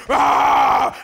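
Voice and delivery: mock growl. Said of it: "growl and".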